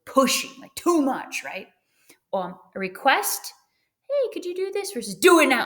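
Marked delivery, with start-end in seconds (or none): 4.1-4.9 polite request. Shown as a demonstration. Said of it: "a request versus a demand". 5.1-5.6 loud, higher barked order; bossy demand. Shown as a demonstration. Teacher contrasts request with a loud demand, barked. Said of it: "a request versus a demand".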